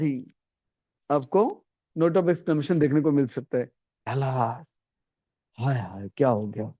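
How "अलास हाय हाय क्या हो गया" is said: low, soft mock wailing; a hammed-up grieving sigh; mock lament. Shown as a demonstration. Teacher showing alas, mild mock grief.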